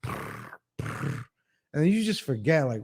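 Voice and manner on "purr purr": soft breathy purring sound; imitated purr. Vlogger imitating his cat purring on him, soft purr. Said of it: "come and just on top of me and go".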